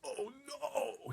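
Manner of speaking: high, wailing oh no; dismayed cry. A narrator's reading. Giant hears a nightmare in the jar, dismayed cry. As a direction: cry out in alarm. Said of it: "he cried".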